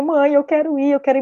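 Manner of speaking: retold child begging to go; imitando filha insistente. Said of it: "ela insistiu". (insistindo como criança, em tom mais agudo)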